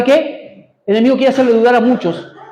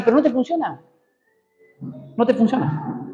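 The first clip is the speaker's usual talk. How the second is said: softly planted doubt; insinuating enemy; 8 dB quieter than usual. Retold or imitated. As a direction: voice the enemy quietly sowing doubt. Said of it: "el enemigo te viene a hablar".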